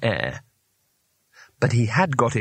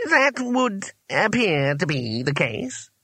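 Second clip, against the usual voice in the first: high, slow, pained admission; slow, lofty wizard voice; stiff master wizard; 7 semitones higher, slower than usual. A narrator's reading. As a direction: concede it with drawn-out dignity. Trapped wizard admits it stiffly.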